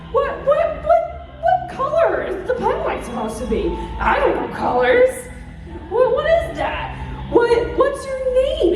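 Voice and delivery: high, flustered confused fan; confused new fan. Retold or imitated. New idol fan at a concert, high flustered babble. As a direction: babble it, lost and excited. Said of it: "be like".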